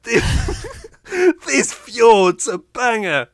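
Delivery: high, loud cockney tourist gushing; cockney accent. Retold or imitated. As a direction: play the excited cockney presenter. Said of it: "incredibly cockney British guy".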